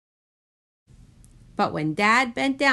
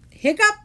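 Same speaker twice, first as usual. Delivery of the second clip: high, loud gasped hiccup; gasping hiccup; 7 dB louder than usual. A narrator's reading. Jonah hiccups during the blessing, high gasp. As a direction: gasp then squeak a hiccup.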